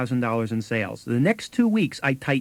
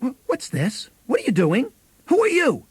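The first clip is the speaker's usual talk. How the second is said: retold alarmed challenge; 11 semitones higher than usual. Big sister finds a salesman in the house, with a rising high alarmed challenge. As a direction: demand answers from an intruder.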